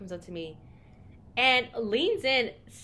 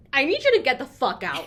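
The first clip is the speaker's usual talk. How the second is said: raised voice; 4 semitones higher, 9 dB louder than usual. Retold or imitated. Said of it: "I raise my voice".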